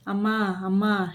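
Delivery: ordinary delivery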